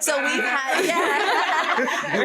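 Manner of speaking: laughing through the words; breaking into laughter. Real, not acted. Laughing about circus job.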